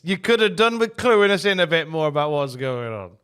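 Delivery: ordinary delivery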